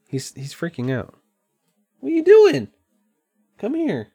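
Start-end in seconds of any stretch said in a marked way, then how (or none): 2.0-4.1 very high-pitched voice for a pet; pet talk. Real, not acted. Owner calls to his cat freaking out, high pet voice. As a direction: call a cat in a high sing-song.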